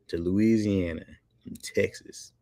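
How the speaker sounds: usual speaking voice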